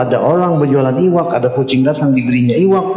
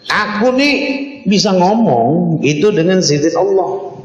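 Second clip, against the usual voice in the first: wolf reassures with a raised voice; talking wolf; 6 semitones higher than usual. Acted. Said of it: "serigalanya menjawab".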